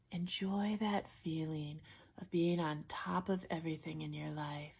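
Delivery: soft, unhurried relaxation-coach voice with long pauses; guided imagery. Acted. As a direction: gently guide them into a safe place, hushed and calm. Leads a guided imagery exercise.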